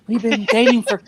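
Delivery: mock her whispery way of talking; whispery girlfriend voice. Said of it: "'Cause it's always like this".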